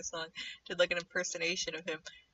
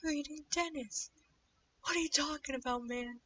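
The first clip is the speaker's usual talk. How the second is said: high, incredulous; playing the stunned viewer; shocked disbelief; 8 semitones higher, 6 dB quieter than usual. Retold shocked reaction.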